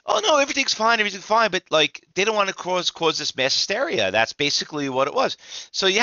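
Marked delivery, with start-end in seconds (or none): 0.1-1.5 high sing-song "everything's fine" mocking officials; much higher mock-soothing brush-off; mock reassurance. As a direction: pitch it up like a fake calm official. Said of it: "I'm like".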